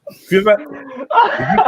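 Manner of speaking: giggling through words; laughing while talking. Real, not acted.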